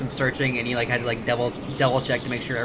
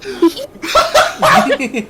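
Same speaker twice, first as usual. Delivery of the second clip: loud high laugh; 9 dB louder than usual. Real, not acted. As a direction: burst of high cackling laughter. Big laugh.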